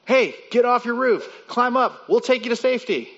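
high, loud call over the rotor noise; shouting from a helicopter. Pretend. Helicopter crew shouting to the stranded man, loud and high. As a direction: yell down to the man on the roof.

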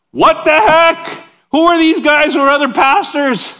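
what the heck, shocked and loud; outraged disbelief. Real, not acted. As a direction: sound scandalised. Outraged at rival preachers.

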